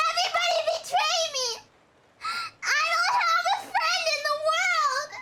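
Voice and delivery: high, loud, fast crying yell; despairing yell. Acted. Abandoned man yelling at the empty room, crying out. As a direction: wail it to the whole world.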